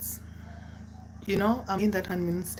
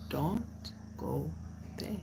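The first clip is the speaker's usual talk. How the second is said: softer, one word at a time; measured warning; 11 dB quieter, slower than usual. Retold or imitated. Oga warning a friend beforehand, slow and quiet. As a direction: separate each word with a pause, quietly.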